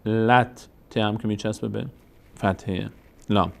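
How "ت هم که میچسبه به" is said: ordinary delivery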